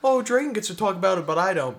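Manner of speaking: mock Sam's jealous whining; mock whine. Teasing friend Sam by imitating his complaint.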